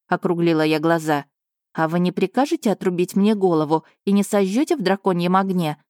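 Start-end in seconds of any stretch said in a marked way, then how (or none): none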